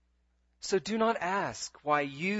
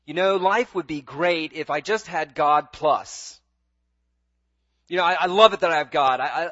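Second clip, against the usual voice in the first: mock grumbling; 9 dB louder than usual. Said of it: "do you find yourself thinking".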